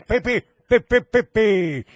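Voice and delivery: slow beeping pi, pi, pi like a machine; mock computer beeps. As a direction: beep on request like a computer. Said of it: "Quiero que la abuela haga pi". (pitidos lentos de computadora, en broma)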